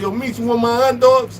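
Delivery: high, held-out friend voice; special ed friend. Retold or imitated. Voicing his special ed friend.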